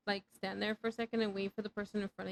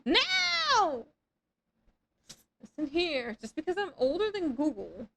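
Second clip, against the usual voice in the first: mock scold; 5 semitones higher than usual. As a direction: bark it like a cranky elder. Scolding chat.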